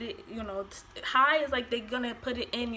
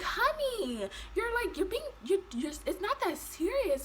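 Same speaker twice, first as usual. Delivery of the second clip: mocking nurse; 8 semitones higher than usual.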